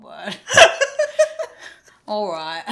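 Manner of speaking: ordinary talk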